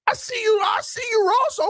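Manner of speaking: calling out. Real, not acted. Host calling out owner Ross, high excited shout. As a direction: shout it up at the owner like a hype man.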